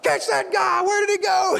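high-pitched mock yell after a runaway; re-enacted frantic doctor shouting; alarmed shout. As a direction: call out in comic panic. Doctor yelling after escaped patient.

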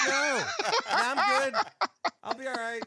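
high, loud, laughing brush-off; mock dismissive refusal. Turning down an eight-hour cut, laughing dismissively. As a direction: wave it away with a laugh.

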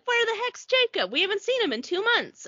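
high-pitched, exclaiming puzzlement; mock bewilderment. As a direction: imitate worried friends wondering aloud, pitch way up. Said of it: "everyone's like".

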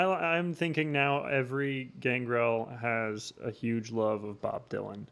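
ordinary talk

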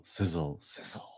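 sizzle fading to a hiss; frying sizzle. Acted. Dad reading to a child, frying sizzle. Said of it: "like an egg in a frying pan".

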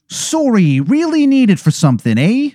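high, pleading mock Canadian accent; Angel of Canada, Canadian accent. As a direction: beg politely in a hammed-up, high Canadian accent. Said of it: "it is a horrible Canadian accent".

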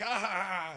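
high, loud war cry; hammed-up triumphant yell; mock battle yell. Waving the beheaded general at the fleeing soldiers, mock battle yell. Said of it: "you're like, waving this thing".

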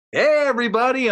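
high, loud cheery host hello; hammed-up announcer hello; mock host greeting. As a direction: greet the camera like a showman. Hosting on camera, retold.

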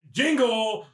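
long, drawn-out showy title call; grand title reveal. Acted. Narrator ending the film recap, drawn-out title flourish. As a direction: stretch the last words into a big finish.

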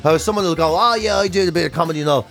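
usual speaking voice

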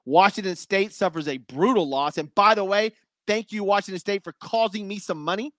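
normal talking voice

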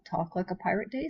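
ordinary talk, nothing marked

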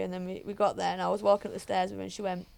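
ordinary talk

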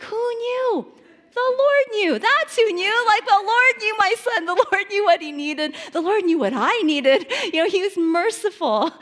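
high, loud exclaiming of the Lord; joyful praise. Real, not acted. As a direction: proclaim it with delighted amazement. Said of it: "joyful exclamation of praise".

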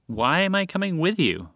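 insistent repeat. A narrator's reading. Moose repeating his question to the lab, insistent. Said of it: "Montgomery repeated".

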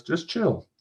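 high-pitched, easygoing friend voice; light, high character voice; chill friend. A narrator's reading. Friend soothing impatient friend in fiction.

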